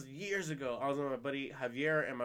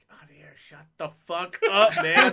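loud, drawn-out mock rebuke; fed-up yell at a pestering drunk; exasperated telling-off; 3 semitones higher, 12 dB louder, slower than usual. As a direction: tell him off, half laughing. Acted telling-off of Javier.

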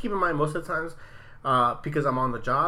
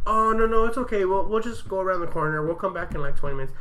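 sweet apologetic actress; 5 semitones higher than usual. Retold or imitated. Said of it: "she's like".

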